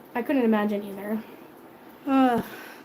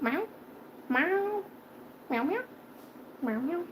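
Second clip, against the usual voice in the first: rising mock meows, fading softer; cat meowing; 4 semitones higher, 10 dB quieter, slower than usual. Owner imitating her cat begging for cheese. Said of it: "She's like".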